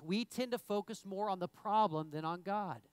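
ordinary talk

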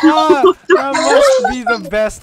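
high, loud, giddy laugh-talk; laughing loudly. Real, not acted. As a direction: gush through the laughter. Cracking up at joke.

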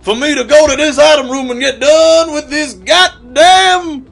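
loud, high-pitched Southern preacher; hammed-up Southern preaching; southern evangelist. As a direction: preach it at full cry, like a revival sermon. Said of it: "It's like you're preaching".

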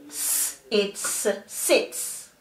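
long hissed "s", "its", then "sits" joined; sounding out and blending. Shown as a demonstration. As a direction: split "s, it's" apart, then blend to "sits". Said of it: "So the syllable is".

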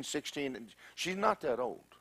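ordinary delivery

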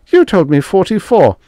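high, loud indignant insistence; alarmed protest. A narrator's reading. Young doctor insisting Stark gave him the number, alarmed. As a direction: throw the blame straight back. Gasping doctor throws blame back.

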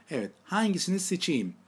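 ordinary talk, nothing marked

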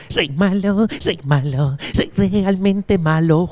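low, slow sung-spoken lines. Pretend.

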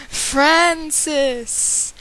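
ghostly howl. Acted. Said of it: "the creature howled in a ghostly voice".

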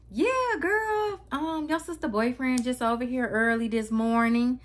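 high imitation of mom, stressing "early this morning"; emphasis on words. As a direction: draw out "Yeah", then punch "early this morning". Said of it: "She keep putting emphasis on early this morning".

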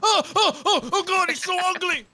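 high, loud startled yelps; terrified high squeal at an ugly monster; panicked shriek. Real, not acted. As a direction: shriek in real fright at the monster. Monster appears on screen.

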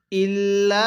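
drawn-out chanted recitation; slow melodic Quranic chant. Shown as a demonstration. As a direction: recite it like a tajweed teacher. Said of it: "কিভাবে পড়ব?".

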